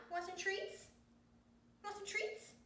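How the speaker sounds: high, rising sing-song coax to the dog; coaxing pet talk. Real, not acted. Owner to her dog, high coaxing pet talk. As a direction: tempt him with the word treats.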